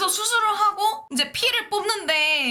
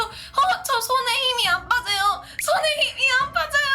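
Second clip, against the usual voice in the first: higher, anxious mock plea; panicked plea; 9 semitones higher than usual. Patient telling the nurse her hand won't relax, panicked. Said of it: "막 이러면서".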